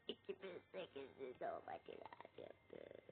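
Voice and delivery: a low, slow whisper to oneself; disappointed whisper. Real, not acted. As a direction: breathe it out in letdown. Muttering disappointed. (hayal kırıklığıyla fısıldama)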